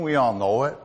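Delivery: ordinary delivery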